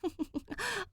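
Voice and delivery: high soft giggle; fond laugh. A narrator's reading. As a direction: laugh fondly at the kids. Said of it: "Jazz laughs at their antics".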